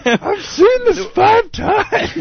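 very high, slow strained voice; hammed-up drawn-out cinema complaint; mock heckling moviegoer. Acts out another cinema heckler.

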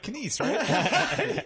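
high, slow put-on voice; squeaky teasing impression; high mock voice. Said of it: "I was like".

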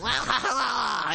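high, shouted monster singsong. Retold or imitated. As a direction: belt it like a goofy monster. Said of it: "he just voices it".

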